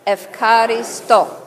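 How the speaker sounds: slow "Efkaristo", syllables drawn out as ef-ka-ri-STO; teaching Greek pronunciation. Shown as a demonstration. Preacher having congregation repeat "Efkaristo", slow ef-ka-ri-STO. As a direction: spell out "Efkaristo" slowly by ear, with an f. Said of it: "Let's try those words".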